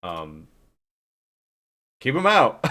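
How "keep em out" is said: high, louder mock cry; playful imitation of a friend's urgent call; Grim Days. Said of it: "I really liked how Grim Days was like".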